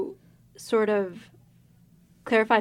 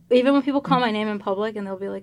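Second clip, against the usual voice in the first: ordinary talk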